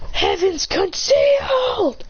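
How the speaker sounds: slow, high mock shout; acted attack call. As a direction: hold the last word long and high. Calling out a final technique.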